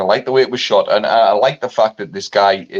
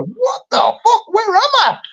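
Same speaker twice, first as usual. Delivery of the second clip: baffled tourist. Retold or imitated. A tourist shocked by France, very high laughing squeal. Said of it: "And you're like that going".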